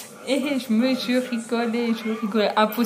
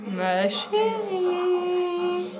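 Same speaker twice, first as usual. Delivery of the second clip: high, very slow, drawn-out ghostly call; eerie voice; 9 semitones higher, much slower than usual. Retold or imitated. The voice heard again at night, very slow high eerie call. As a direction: let the words float out long and high like a phantom. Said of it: "c'est dans mes rêves".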